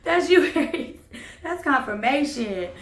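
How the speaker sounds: high, loud words through laughter; real laughing. Real, not acted. Reader tells Aries the card is them, laughing. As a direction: crack up while talking.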